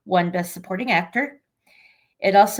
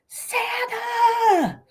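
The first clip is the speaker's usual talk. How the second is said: excited shout. Retold or imitated. Quoting Elf's excited shout.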